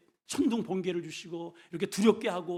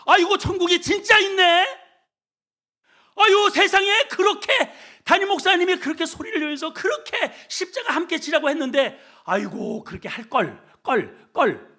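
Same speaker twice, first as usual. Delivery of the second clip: much higher and louder, laughing lament; loud regretful wail; 13 semitones higher, 12 dB louder than usual. Acted. Believer at heaven's gate regretting, loud laughing lament. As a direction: cry out at heaven's gate, too late.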